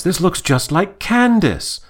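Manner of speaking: high, drawn-out put-on character voice; imitating Lawrence. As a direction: quote him slowly, pitched well up. Voicing Lawrence's line again.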